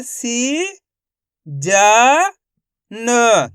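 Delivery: "सी", "जा", "न" each drawn out; syllable by syllable. Shown as a demonstration. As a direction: pause after each of "सी", "जा", "न". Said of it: "एक ही अक्षर का उच्चारण".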